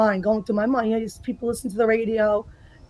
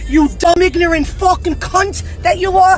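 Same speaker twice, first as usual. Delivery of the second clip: furious rant; 5 semitones higher than usual. Acted. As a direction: rage at the politician, full force. Played viral political rant.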